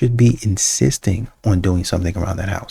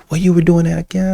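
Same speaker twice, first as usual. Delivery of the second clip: mimicking excuse; 7 semitones higher than usual.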